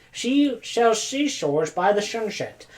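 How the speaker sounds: She sells seashores said with every s as sh; slow playful lisp, s turned to sh; lispy tongue twister. Real, not acted. Lisping tongue-twister play.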